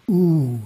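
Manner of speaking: a long rounded Oo held out; prolonged oo. Shown as a demonstration. Teacher demonstrating the second syllable of Om, held. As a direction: stretch the Oo evenly. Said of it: "but prolonged".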